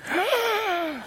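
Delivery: shocked gasp. Retold or imitated. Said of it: "The people are in church going".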